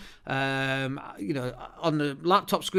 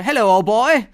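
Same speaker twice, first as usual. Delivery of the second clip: a louder, higher put-on upper-class British accent; mock upper-class British accent; 5 semitones higher, 8 dB louder than usual. Pretending to meet people in real life, a mock upper-class British accent. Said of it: "my accent is my accent".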